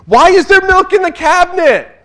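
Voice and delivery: high, loud exasperated shout; ridiculous yell. Retold or imitated. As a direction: yell it at the whole house in disbelief. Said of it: "I yelled one of those ridiculous things".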